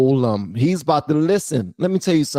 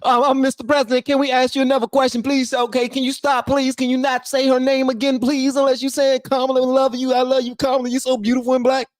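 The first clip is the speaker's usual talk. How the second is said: high, pleading mock-reporter voice; 11 semitones higher than usual. Streamer mimics journalists pleading with Trump about Kamala in high mock voice. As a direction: play the journalists begging him to stop naming her.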